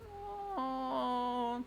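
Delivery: long high-pitched coo; touched "aww". Real, not acted. Reader moved by happy tears, touched coo. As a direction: let out a drawn-out high aww.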